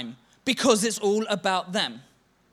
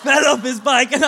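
laughing excitement; 4 semitones higher, 10 dB louder than usual. Real, not acted. Laughing retelling friend's crash.

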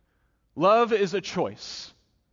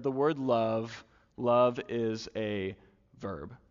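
slow lyric recital; 5 semitones lower, 5 dB quieter, much slower than usual. Shown as a demonstration. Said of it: "main verses here with this song".

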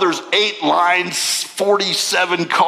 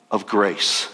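ordinary talk, nothing marked

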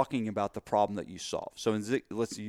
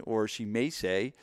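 ordinary talk

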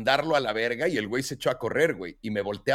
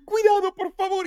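much higher pleading warning; wife warns him off the coyote, higher and pleading; wife's warning; 4 dB louder than usual. Retold or imitated. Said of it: "me dice".

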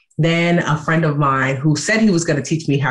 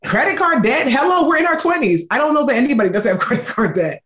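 high, teasing, laughing dismissal; 9 semitones higher than usual. Retold or imitated. Friend mocking the shame, high and laughing. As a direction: wave the drama away with a laugh. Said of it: "she started laughing".

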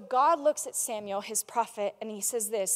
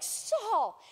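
high, soft, drawn-out falling plea; acted heartbreak, letting the name fall away; brokenhearted; 8 semitones higher, 6 dB quieter than usual. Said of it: "he's brokenhearted and he's like".

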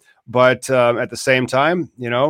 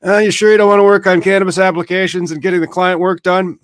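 mock nagging voice, higher and louder; a hammed-up put-on voice nudging himself back to work; playful fake voice; fake accent; 7 semitones higher, 4 dB louder, faster than usual. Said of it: "my fake accents today".

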